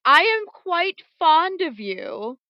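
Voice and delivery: high, slow, wounded protest; mock hurt. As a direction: play offended, drawn out. Mock-offended hostess.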